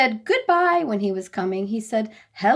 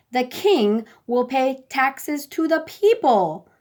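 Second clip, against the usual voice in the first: higher, slow, voiced king giving an order; royal order; 4 semitones higher, 4 dB louder, slower than usual. A narrator's reading. King Wacky ordering his people, slow and grand. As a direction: decree it grandly, slowly. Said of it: "King Wacky ordered".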